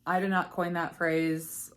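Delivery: ordinary delivery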